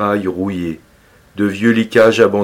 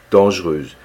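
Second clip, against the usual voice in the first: ordinary delivery, nothing marked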